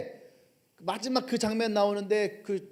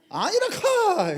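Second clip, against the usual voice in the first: high, slow Gyeongsang dialect retort; laughing Gyeongsang dialect retort, drawn out; Gyeongsang dialect shout; 12 semitones higher, much slower than usual. Acted. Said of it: "경상도 갈릴리 사투리를 계속 쓰고 있는데". (경상도 사투리로 길게 버럭)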